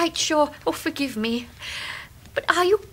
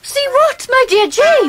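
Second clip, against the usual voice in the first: high and loud, suddenly frightened; startled; 9 semitones higher, 9 dB louder than usual. Acted. Mother startled by Jane's cry, loud and alarmed.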